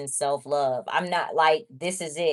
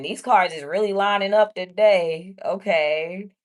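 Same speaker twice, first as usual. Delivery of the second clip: excited; 3 semitones higher, 6 dB louder than usual. Real, not acted. Excited by matching cards.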